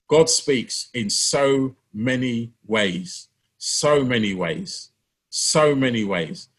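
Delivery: drawn out slowly, each word weighed; slow emphasis. Acted. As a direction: linger on every word like a preacher. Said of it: "repeated slowly for emphasis".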